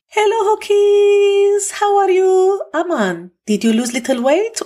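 put-on Mema's Armenian accent. Armenian grandmother greeting grandkids, high drawn-out Armenian accent. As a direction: stretch it out high like a doting Armenian granny. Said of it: "I'm gonna do an accent now".

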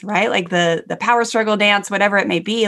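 ordinary delivery

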